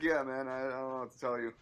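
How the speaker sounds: normal talking voice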